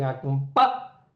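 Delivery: "acompá" drawn out slowly, landing on PA; stress on PA. Shown as a demonstration. Lecturer showing where the verse accent falls, PA hit in "acompá". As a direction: stretch "acompá" and hit the final PA. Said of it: "hace el acento en".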